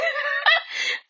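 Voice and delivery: a sudden high burst of real laughter. Real, not acted. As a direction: break into a high laugh at your own word. Laughs at her own word.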